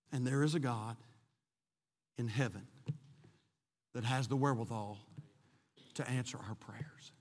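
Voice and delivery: low, soft preaching with long pauses; gentle sermon close sinking near a whisper; hushed exhortation. Real, not acted. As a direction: encourage them quietly, phrase by phrase. Quiet exhortation after climax.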